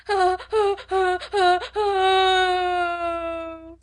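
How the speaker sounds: put-on long, high, loud child wails; little girl howling and crying; tempestuous howling. A narrator's reading. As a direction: howl the tantrum out. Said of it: "Lottie howled tempestuously".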